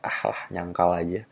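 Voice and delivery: low, quiet dismissive aside; knowing scoff. Acted. Man watching her deny it with a slight smile, low knowing scoff. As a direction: mutter it softly to yourself. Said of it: "senyum-senyum sedikit".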